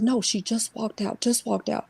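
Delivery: mother answering quietly, scared; hushed mother. Retold or imitated. Mother voiced, hushed reply.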